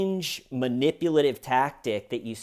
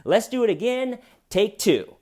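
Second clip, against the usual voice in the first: higher, louder director calling a retake; hammed-up film director; 9 semitones higher, 4 dB louder than usual. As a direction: call the next take like a cheery director. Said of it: "if I was the director".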